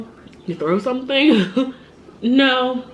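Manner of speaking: laughing through the question. Real, not acted. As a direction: laugh at how silly it is. Laughing at the absurd answers.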